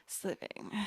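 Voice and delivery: Paris Hilton sliving. Acted. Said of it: "with the Paris Hilton accent".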